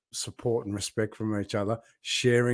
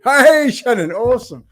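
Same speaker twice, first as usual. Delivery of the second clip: loud, high, delighted hello; light up as she joins the live; real burst of delight at seeing her; excited greeting; 12 dB louder than usual. Real, not acted. Greets viewer in live chat.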